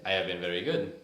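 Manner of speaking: loud, amateur Indian accent; copying a friend's Indian accent; attempted Indian accent. Shown as a demonstration. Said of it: "Is that how you do it?".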